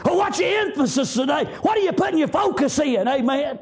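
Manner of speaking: ordinary talk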